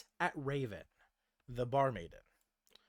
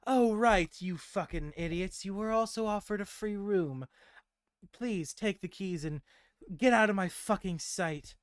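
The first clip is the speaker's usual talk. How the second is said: mimicked daughter voice, raised and curt; Gwendolyn; 6 semitones higher, 4 dB louder than usual. Raven handing out keys in borrowed voice, rudely dismissive. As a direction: keep the higher put-on voice but make it unkind. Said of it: "still talking like Gwendolyn".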